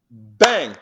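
a shouted, high "bang!"; mock gunshot. Telling the interviewer about the prank, shouting a gunshot bang. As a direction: burst out with a gunshot noise. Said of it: "and I'm like".

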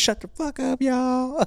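very high mock outburst; high-pitched squawk of comic indignation; retold protest. Laughed-at friend telling the group off, high mock protest.

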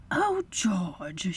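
soft, dismayed sigh; reproachful wife. A narrator's reading. Wife smells the bowl, softly reproachful. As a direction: say it softly with disappointment.

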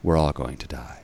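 low, soft, deadpan delivery; resigned philosopher. Retold or imitated. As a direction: play a philosopher calmly stating the grim truth. Philosopher's deadpan meme punchline.

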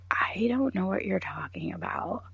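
hushed, unvoiced denial; retold whispered denial to HR. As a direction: whisper it in disbelief. Denying it in HR office.

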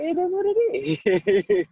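high, carefree mock shrug. Telling her it is what it is, high carefree shrug. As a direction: toss it off like nothing matters.

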